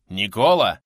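loud call of a name. A narrator's reading. Man shakes his fallen friend, calling out loudly. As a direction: call loudly to rouse him.

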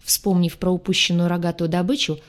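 ordinary delivery

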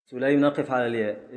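ordinary talk

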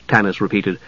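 plain reading voice

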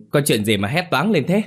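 calling out while running. A narrator's reading. Old man runs toward the scream, calling out. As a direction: shout the question as you run toward the noise. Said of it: "Ông Đông vừa chạy vừa hỏi".

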